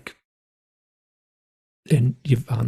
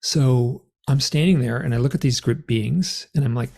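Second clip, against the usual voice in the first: usual speaking voice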